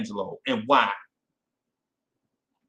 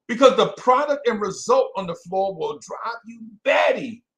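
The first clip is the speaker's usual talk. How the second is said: exasperated shout; 6 semitones higher, 4 dB louder than usual. Real, not acted. Host venting about the Knicks' play, exasperated shout. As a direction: voice jumps up loud and high.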